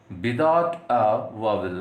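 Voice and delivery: very slow, word by word; slow dictation. Shown as a demonstration. Teacher dictating to students, slow and deliberate. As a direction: read it out slowly so students can write.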